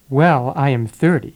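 ordinary talk, nothing marked

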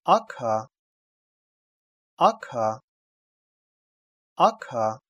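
"ака" with a voiceless, puffed "к"; read as "ака". Shown as a demonstration. Teacher reading out a letter combination, "ака" with voiceless "к". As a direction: say "ака" in two distinct syllables. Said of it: "читается".

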